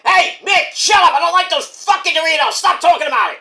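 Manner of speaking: high, loud, irritated put-on voice; Mike Awesome impression. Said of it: "No, Mike Awesome doesn't like Doritos".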